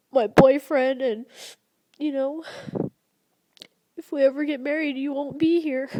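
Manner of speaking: real crying, voice pitched high; tearful, very emotional. Real, not acted. Daughter apologizing to her late mother, real tearful emotion. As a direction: choke through it in a high, tearful voice. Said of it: "I'm getting very emotional".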